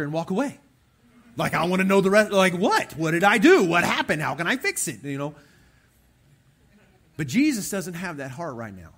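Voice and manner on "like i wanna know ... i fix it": loud, high, insistent questioning; worked-up, hammed-up bewildered protest; acted urgent demand. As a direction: fire off questions like a man desperate to fix it. Acts man insulted, demanding answers.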